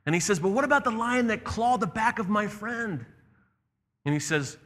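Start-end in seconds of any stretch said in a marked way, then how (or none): 0.4-3.0 put-on high, indignant child's challenge; protesting boy. The boy challenges the lion over his hurt friend, protesting. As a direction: demand an answer in a raised pitch.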